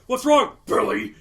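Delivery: loud, high cartoon yell; mock taunting shout. Imitating the cartoon frog addressing a crying kid, loud mock taunt. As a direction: holler it at an imaginary kid.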